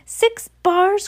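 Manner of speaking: put-on excited high-pitched greedy boy; greedy boy's cry. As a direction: squeal it like a greedy kid hearing about candy. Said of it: "cried the greedy little swine".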